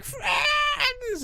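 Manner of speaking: very high, loud joke screech; screaming creature. Acted. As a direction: shriek it like an overexcited friendly monster. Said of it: "That's why they're screaming".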